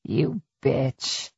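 low, understated musing; casual, reflective. A narrator's reading. Attacker to the woman begging for her life, casually reflective. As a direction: insult as if idly remarking on the weather. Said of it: "tone of a man reflecting on the weather".

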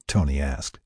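usual speaking voice

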